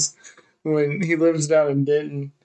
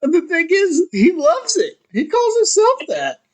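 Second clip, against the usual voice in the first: high, wheezy laughter through the words; laughing while talking; 13 semitones higher than usual. Real, not acted. Friend telling how buddy loves his nickname, cracking up. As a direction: barely get it out for laughing.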